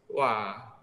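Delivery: ordinary talk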